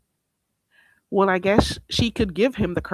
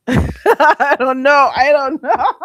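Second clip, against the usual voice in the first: laughing; 8 semitones higher, 9 dB louder, slower than usual. Real, not acted. Laughing helplessly.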